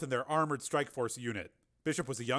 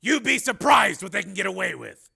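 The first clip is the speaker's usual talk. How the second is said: higher, loud warning; forceful Steele; 7 semitones higher, 13 dB louder than usual. A narrator's reading. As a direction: warn with hard emphasis.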